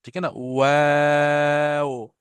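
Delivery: वाव held very slowly, lips rounding then spreading; drawn-out waw. Shown as a demonstration. Teacher repeating the letter for students, slow drawn-out वाव. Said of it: "हमारे मुंह से जो लफ्ज निकल रहा है".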